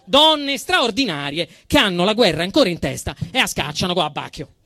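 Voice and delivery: normal talking voice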